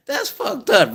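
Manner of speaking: says it through a laugh. Real, not acted. Laughing at what smokers pay, words through a laugh. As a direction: laugh while you say it.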